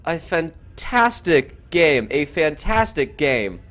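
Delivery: drawn out, stunned praise; slow, awed wrap-up. Real, not acted. Caster to viewers after the final, slow awed wrap-up. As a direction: savour each word after the upset.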